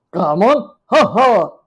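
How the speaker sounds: loud cawing magpie call with a laugh; loud bird voice cackling; magpie doctor. Acted. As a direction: caw it out like a cheeky bird.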